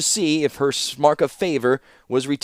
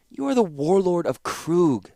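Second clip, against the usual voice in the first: coldly; 7 semitones higher than usual. A narrator's reading. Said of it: "said Kayla coldly".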